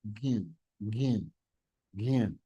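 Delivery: gyan said low and soft, gin; soft low gyan sound; demo gyan. Shown as a demonstration. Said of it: "That is the sound".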